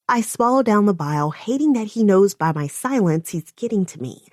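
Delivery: usual speaking voice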